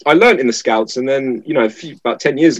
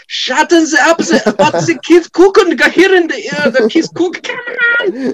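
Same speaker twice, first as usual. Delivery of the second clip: put-on high, exasperated German-accented outburst; cracked old German; slower than usual. Old German man fed up with sauna impressions, mock outburst. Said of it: "some German ... some old man, just cracked".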